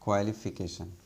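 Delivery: ordinary talk, nothing marked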